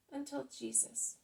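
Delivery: ordinary delivery, nothing marked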